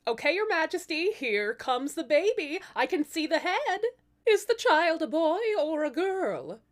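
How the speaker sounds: high, bright-voiced birthing nurse; midwife voice. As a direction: coach the queen through the birth. Midwife delivering the royal baby.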